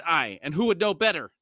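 half-hearted acted shout; mild shout. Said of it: "She shouted".

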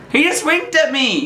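ordinary talk

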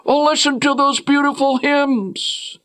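high, gushing churchgoer voice; mock pious worshipper. Preacher mocking a deceived worshipper, high and gushing. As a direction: gush like a fooled believer. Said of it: "and say".

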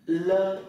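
"Lơ" held long on a high level tone; demonstrated syllable "Lơ". Shown as a demonstration. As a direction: sustain the vowel of "Lơ", teacher drill. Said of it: "Đọc là ơ khi đi cùng L". (đọc "Lơ" ngân dài, giọng cao đều)

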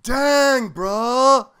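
loud shocked outburst; loud exclamation. Real, not acted. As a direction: shout it out in disbelief. Reacting to no-mirror rule.

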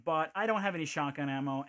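normal talking voice

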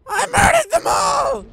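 very high, loud crowing shout; manic shout. Pretend. Player boasting after killing the characters, high manic shout. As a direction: shriek it in mock-maniacal triumph.

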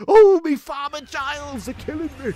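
high, loud put-on complaining voice; high, loud hammed-up groan; comic moan. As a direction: wail it like a pantomime sufferer. Comic character moaning.

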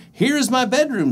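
high, cheerful little mouse. Acted. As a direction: show it off brightly. Mouse speaks.

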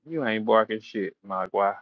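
normal talking voice

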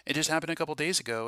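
ordinary delivery

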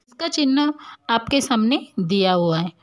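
ordinary delivery

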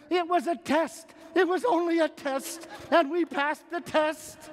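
high, jubilant, slowing at the end; triumphant announcement. Retold or imitated. Husband telling his wife they passed, jubilant. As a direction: proclaim the good news. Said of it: "I said to her these words".

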